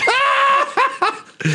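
very high, piercing burst of laughter; shrieking laugh. Real, not acted. Laughing at a crude joke.

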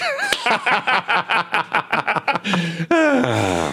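long, high-pitched cackling laugh; high cackle. Real, not acted. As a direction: cackle helplessly at your own dirty joke. Laughing at own dirty punchline.

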